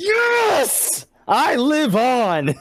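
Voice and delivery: high, slow triumphant shout; excited cheer. Real, not acted. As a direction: cheer like you survived. GameStop staying open, cheering.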